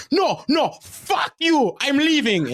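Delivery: high-pitched, loud screaming impression of a furious father, laughing through it; imitated raging dad. Coach imitates the dad screaming at him about benching his son, high furious yell. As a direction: shriek it like an enraged parent losing it at the coach. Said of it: "going nuts".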